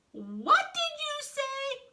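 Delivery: very high, drawn-out indignant squawk; high cartoon outrage; outraged. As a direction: screech it slowly, offended. Offended reply to insult.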